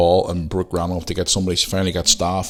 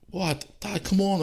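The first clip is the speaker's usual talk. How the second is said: high, softer put-on kid's voice; imitating daughter; 12 semitones higher, 5 dB quieter, slower than usual. Daughter answering her dad, high imitated complaint. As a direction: mimic an impatient child, pitched right up. Daughter's annoyed reply, retold.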